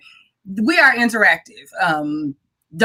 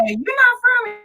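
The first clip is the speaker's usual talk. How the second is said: high doubtful question; higher mock question from hometown folks; mock local voice. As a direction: imitate a skeptical local, high. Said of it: "people still say".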